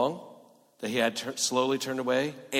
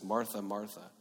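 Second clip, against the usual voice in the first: soft, tender repeated name; intimate call; 3 semitones lower, 7 dB quieter than usual. Acted. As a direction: call her gently, close to her. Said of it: "it's for intimacy".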